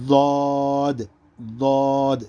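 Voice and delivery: ضاد thick and rounded, vowel held long on one note; heavy, full ضاد. Shown as a demonstration. Teacher demonstrating the letter, ضاد heavy and stretched. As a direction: press tongue side to molars for ضاد. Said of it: "موٹا پڑھنے".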